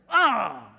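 sharp loud retold yelp; sudden loud ouch; yelp of pain. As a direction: cry out as the sting hits. Retold getting stung.

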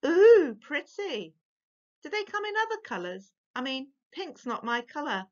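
high, swooning mock admiration; teasing brothers fake-gush over the necklace, high and mocking; mock appreciation. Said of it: "in mock appreciation of her necklace".

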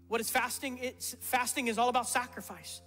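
normal talking voice

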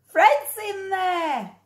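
high, slow alarmed cry; high worried warning; urgent call. Acted. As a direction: call out that Fred's inside. Someone warns about the bear.

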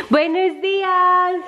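slow, high, sing-song; sing-song greeting. Real, not acted. Greeting baby in Spanish.

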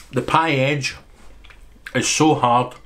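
chewing while talking; covering mouth while chewing; mouth full of pie. Real, not acted. Seen 0: Bites pie at -1.0, then chews behind his hand until 3.2.

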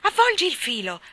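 high, loud command; shouted order. A narrator's reading. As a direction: call it out urgently. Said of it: "gridò il guardiano". (ordine gridato)

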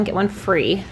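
normal talking voice

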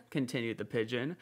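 ordinary delivery

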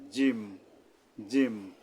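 জিম pressed hard, tongue middle against the palate; firm Arabic jim. Shown as a demonstration. Teacher drilling the letter jim for viewers, firm and pressed. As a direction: hit জিম firmly from the palate. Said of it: "শক্তভাবে জিম উচ্চারণ করতে হবে".

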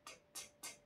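つ as a bare ts click, no vowel; repeated unvoiced t bursts on つ; voiceless T sound. Shown as a demonstration. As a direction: tick out つ with breath only. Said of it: "子音のTの音は".

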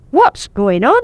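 plain reading voice

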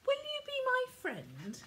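put-on high, hopeful little boy's plea; whispered. Ben asks the goldfish, high child's whisper. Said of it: "he whispered to Edwina".

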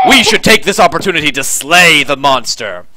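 loud, declamatory commander voice; grand call to arms; rallying commander. Acted. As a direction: address the hall as its commander. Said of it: "The commander stands up and says".

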